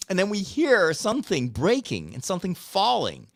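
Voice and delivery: normal talking voice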